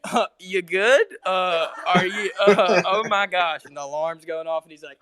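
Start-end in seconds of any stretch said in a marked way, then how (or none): 0.1-3.7 slow, higher retold check-in; drawn-out questions breaking into amusement; imitating Rick. Friend retelling what he heard Rick say, imitated slow concern. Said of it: "Rick saying".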